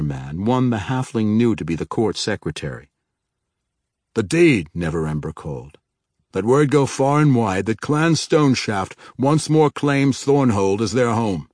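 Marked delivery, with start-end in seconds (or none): 4.1-4.7 calling out. Acted. Neverember calls out order. 6.3-11.5 loud, high proclaiming lord; proclamation. Acted. Neverember proclaims the claim.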